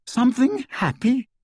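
high, cracked disbelieving question; voice cracking. A narrator's reading. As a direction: voice breaking upward on a question. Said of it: "his voice cracked".

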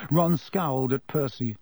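usual speaking voice

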